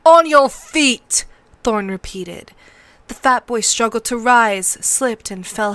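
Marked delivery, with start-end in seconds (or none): 0.0-1.2 loud, high, slow command; drawn-out, raised order to get up; barked order. Acted. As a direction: shout it as a drill master. Drill master repeats his order.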